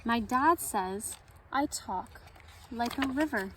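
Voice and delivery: gentle read-aloud storytelling; story narration. A narrator's reading. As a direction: read it like story time for kids. Reads picture book at story time.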